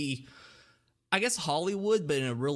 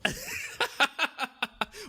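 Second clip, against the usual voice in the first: 1 high laugh. Real, not acted. Laughing at workshop idea.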